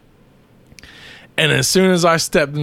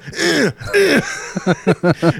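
effort grunts; 11 semitones higher than usual. Retold or imitated. Grunting while kicking dog.

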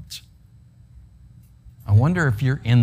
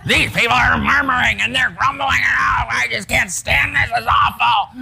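shrill moaning complaint, overplayed; whiny grumbler; 9 semitones higher than usual. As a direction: ham up a fed-up grumbler who can't stand it. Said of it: "You see what it sounds like?".

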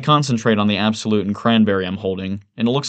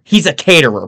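loud, raised-pitch outburst; crying out; 7 semitones higher, 10 dB louder than usual. A narrator's reading. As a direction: cry it out as the clinching point. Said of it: "Price cries out".

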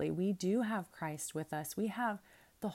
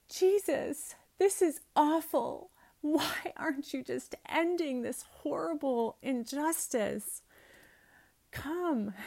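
much higher and louder pleading outburst; desperate plea; 8 semitones higher, 4 dB louder than usual. Retold or imitated. Preacher voicing her own plea to Jesus, desperate. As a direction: beg Jesus to step in now. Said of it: "I'm like".

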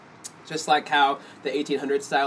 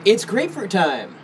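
ad slogan voice; 6 semitones higher, 3 dB louder, slower than usual. Retold or imitated. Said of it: "it's almost like".

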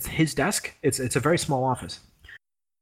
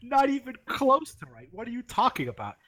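high-pitched imitation of a scornful professor; mocking dismissal; 9 semitones higher than usual. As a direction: act out a teacher ridiculing a student's answer, high voice. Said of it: "He laughed at him".

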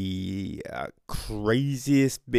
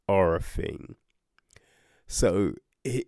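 ordinary delivery

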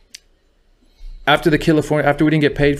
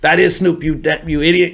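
higher, scratchy mock-scolding homie; raised, put-on scratchy voice; Big C Style's voice; 6 semitones higher than usual. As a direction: imitate him calling you an idiot. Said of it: "that's how he talked. His voice was kinda scratchy".

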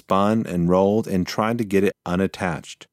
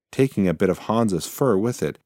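usual speaking voice

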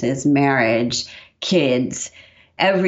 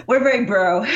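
laughing through the words; 7 semitones higher than usual. Real, not acted. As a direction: giggle it out. Joking about casual prayer.

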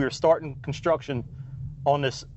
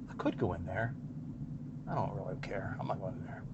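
muttered aside; 8 semitones lower, 15 dB quieter than usual. Real, not acted. Muttered aside about the store.

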